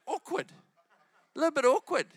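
high-pitched sheepish aside; mock awkwardness. Ten people queued up behind her, awkward. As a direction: squeak it out as an uneasy aside. Voicing discomfort before the queue.

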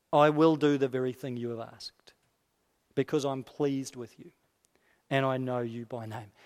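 low, measured scripture reading; solemn, quiet granting of a request; God's answer. Acted.

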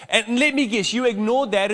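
ordinary talk, nothing marked

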